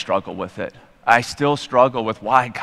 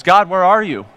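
desperate cry to God; 7 semitones higher, 5 dB louder than usual. Retold or imitated. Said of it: "and he goes".

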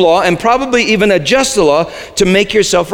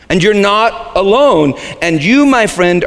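usual speaking voice

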